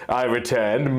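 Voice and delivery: ordinary delivery, nothing marked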